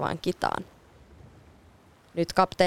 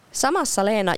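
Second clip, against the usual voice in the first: plain reading voice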